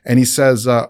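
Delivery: normal talking voice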